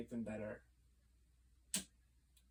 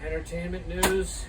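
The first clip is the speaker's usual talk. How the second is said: mock news anchor; 5 semitones higher, 8 dB louder, slower than usual. Mock anchor handing off segment.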